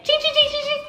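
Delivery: high, playful mock till chimes; a run of high cash-register dings; cash register chings. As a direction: ring up each cost like a register.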